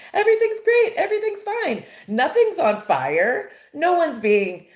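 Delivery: high, loud mock-cheerful employee; hammed-up forced cheer; fake happy. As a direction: pretend all is wonderful, sarcastically over-bright. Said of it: "pretend to be happy employees".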